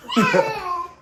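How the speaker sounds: short high burst of laughter; embarrassed laugh. Real, not acted. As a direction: laugh it off awkwardly. Laughs after hushing son.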